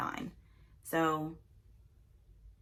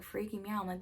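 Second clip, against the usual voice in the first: usual speaking voice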